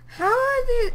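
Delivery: very high, loud shriek; shrill incredulous cry; outraged disbelief. Real, not acted. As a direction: squeal in disbelief. Baffled by enemy.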